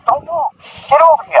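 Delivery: high-pitched cop command; retold police order. Cops ordering him at arrest.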